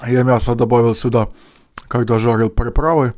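chewing while talking; mouth full. Real, not acted. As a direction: food in mouth. Seen 0: Spoonful eaten at -5; cheeks full, spoon of mash held while speaking.

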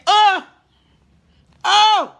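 high, loud surprised gasp of oh. Real, not acted. Streamer reacting to chat comment, surprised oh. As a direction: react with a sudden oh.

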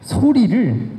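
usual speaking voice

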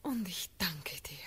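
soft, low, breathy gratitude; moved whisper of thanks. Acted. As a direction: breathe the thanks almost silently. Grateful whisper to the Elector.

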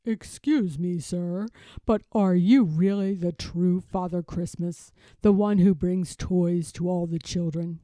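a hint of a big, courteous storybook character; Babar. A narrator's reading. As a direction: ask politely in a low voice. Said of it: "greets him and says".

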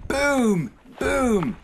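loud, high, long-held booms; great booming. A narrator's reading. Narrator imitating noise from the trees, great booming. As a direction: boom it out like a drum. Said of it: "heard a great booming noise".

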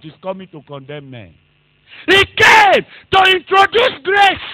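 loud, high, shouting sermon delivery; fiery preaching. Acted. Preacher warning the church of the coming judge, fiery shouting. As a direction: thunder it out to the congregation.

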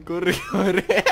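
loud laughing yell to run; being chased. Real, not acted. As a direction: shout it through laughter. Chased by killer.

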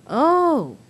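high, loud swooping oh; surprised realization. Acted. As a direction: let the news land with surprise. Duck reacts to learning the truth.

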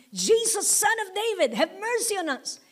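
high pleading call; imitated cry for mercy. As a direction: cry out to Jesus from a distance.

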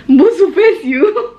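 laughing. Real, not acted. Laughing repeat of the insult.

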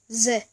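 제 with a buzzing z at the start; 제 said alone as a foreign z sound; demonstrated letter sound. Shown as a demonstration. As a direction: model 제 as a voiced, buzzed "ze". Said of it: "제 소리가 납니다".

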